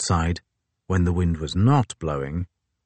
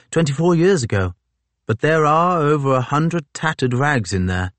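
higher officer's voice; a higher, gentlemanly officer; Fitzjames voice; 9 semitones higher, 3 dB louder than usual. A narrator's reading.

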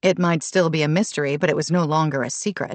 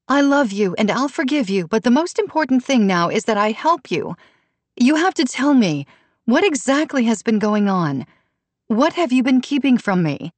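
high-pitched, earnest mother's appeal; urgent pleading; 7 semitones higher than usual. A narrator's reading. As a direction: press her to come clean. Mother pressing daughter to confess.

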